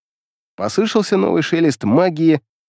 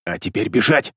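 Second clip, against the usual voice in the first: hissing; 5 semitones lower than usual. A narrator's reading. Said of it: "как лис прошипел".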